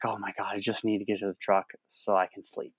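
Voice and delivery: quiet, drained, almost under the breath; exhausted mutter. Retold or imitated. Worn-out hiker to himself near the truck, quiet and drained. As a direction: sigh it out, spent and wanting sleep.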